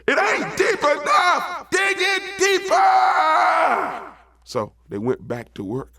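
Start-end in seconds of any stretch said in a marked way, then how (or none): 0.1-4.1 high, slow, stretched-out holler; piercingly high drawn-out yell; bossy rabbit shout. Acted. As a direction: shout the order down to diggers. Rabbit orders frogs to keep digging.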